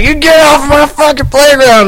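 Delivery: put-on high shouted drunk order; drunk yell. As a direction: bark it like a drunk kid-bully. Drunk friend yelling at kids.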